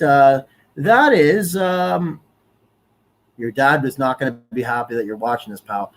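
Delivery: ordinary talk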